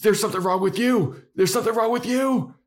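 high, fast, insistent denial; Buffy in denial. Retold or imitated. Buffy denying Spike's claim, high and fast. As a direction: throw it back at him, fast and high. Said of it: "And Buffy's like".

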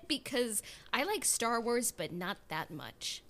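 ordinary delivery

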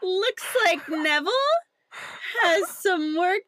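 hammed-up camp villain. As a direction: play a theatrical schemer, pausing mid-line. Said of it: "And then he goes".

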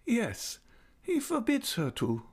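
put-on high, tender old lady's voice; fond old lady. A narrator's reading. Old lady invites Raoul near, voice high and fond. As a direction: coax him close like a loving old aunt.

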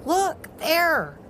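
high shouted call; holler. A narrator's reading. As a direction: call out pointing at something. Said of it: "heard Power Dog holler".

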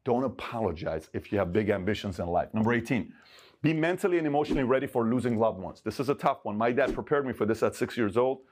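ordinary talk, nothing marked

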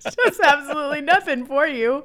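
talking through laughter; laughing hard while talking. Real, not acted.

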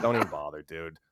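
low, quiet brush-off; dismissive refusal. Retold or imitated. Retelling his refusal to the Angels, dismissive. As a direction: turn it down low and under the breath.

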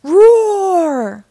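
loud, high playful roar; lion roar. Acted. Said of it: "I'm a lion".

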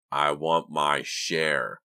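very slow, hoarse demand; menacing croak. A narrator's reading. Anonymous caller demands a share, menacing croak. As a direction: draw out each croaked word threateningly. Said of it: "the voice croaked low and menacing".

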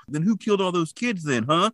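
high, hammed-up accusing yell; overacted townsman demanding answers; mock angry Bob. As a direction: play Bob over the top, pushing back. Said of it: "Bob's like".

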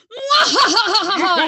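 loud high cackling villain laugh; evil laugh. Pretend. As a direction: cackle like a mad scientist. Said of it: "Everybody evil laugh".

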